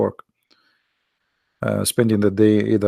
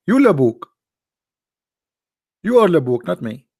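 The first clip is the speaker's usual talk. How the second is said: louder and higher, throwing "Labuk" back; mock retort; 5 semitones higher, 4 dB louder than usual. Real, not acted. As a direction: toss the insult right back, teasing. Playful retort to insult.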